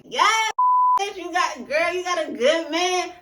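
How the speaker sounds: high, loud squealing hype; hype excitement. Retold or imitated. Cheering on a friend's great man, squealing high and loud. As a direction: gush like a best friend cheering. Hyping up [name]'s couple.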